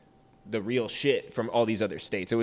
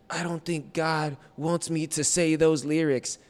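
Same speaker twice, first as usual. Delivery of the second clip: higher, slow reluctant voice; hesitant born-again rapper; 7 semitones higher, slower than usual. Retold or imitated. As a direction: hold back, worried about God.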